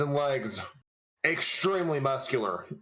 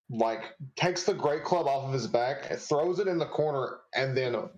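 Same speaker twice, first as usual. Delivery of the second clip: usual speaking voice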